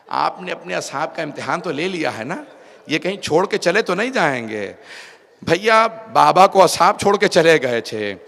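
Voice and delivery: fervent preacher retelling a dialogue; impassioned mourning recitation. Acted. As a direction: voice the sister's worry with full force. Retold anxious sister and reply.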